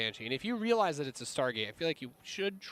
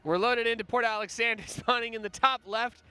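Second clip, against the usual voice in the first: announcer voice; 7 semitones higher, 4 dB louder than usual. Acted. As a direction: introduce the players like a ring announcer. Player introductions.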